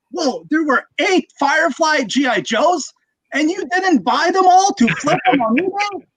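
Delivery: put-on disbelief, mimicking someone online; mocking commenter.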